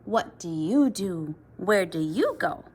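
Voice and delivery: plain reading voice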